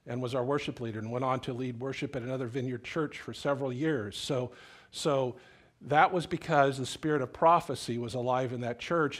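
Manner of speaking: ordinary talk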